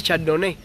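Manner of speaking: Chardonnay ending in "né"; viewers' correction. Shown as a demonstration. Streamer reading out correction from viewers, Chardonnay ending "né". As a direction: say Chardonnay with stressed final "eh". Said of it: "que se dice Chardonnay".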